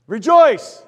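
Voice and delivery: a joyful shout to the church; 1 loud call. Real, not acted. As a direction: proclaim it to the whole room. Preacher calls out to congregation.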